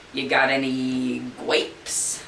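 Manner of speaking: slow, drawn-out cartoon duck. Duck asking the clerk for grapes, slow silly voice. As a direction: ask it like a dopey duck, slowly.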